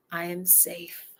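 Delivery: ordinary delivery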